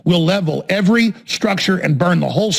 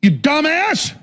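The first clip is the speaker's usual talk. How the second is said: high-pitched angry cry; shrieking telling-off, much higher; exasperated outburst; 11 semitones higher, much slower than usual. Real, not acted. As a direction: yell it in sheer exasperation.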